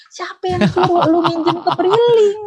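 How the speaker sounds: high, slow incredulous jab with a laugh; high mocking retort to a borrower; exasperated retort. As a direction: scoff at her choice, half laughing.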